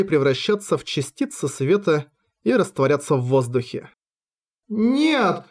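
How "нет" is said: exclaiming. A narrator's reading. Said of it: "воскликнула Риса".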